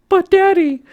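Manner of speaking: put-on very high, loud girlish protest; a falsetto daughter pushing back; high pleading mermaid girl; Ariel voice.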